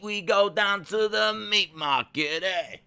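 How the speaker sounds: high, hammed-up Italian wiseguy accent; put-on Italian accent. Streamer imitating an Italian wrestler for the chat, put-on Italian accent. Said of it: "Daisy's Italian accent sound more like Russian".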